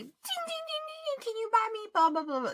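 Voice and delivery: high sing-song dad imitation; dad's ding ding voice. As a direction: chime it out like a phone alert. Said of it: "he's like".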